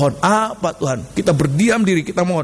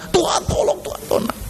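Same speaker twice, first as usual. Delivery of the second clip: mock frightened prayer. Preacher showing how not to pray, mock frightened pleading. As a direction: imitate someone praying in fright. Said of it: "doa seperti orang ketakutan".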